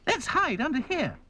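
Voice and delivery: urgent, very high doll voice; high-voiced, hurried command; crying out. A narrator's reading. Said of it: "cried Dotty".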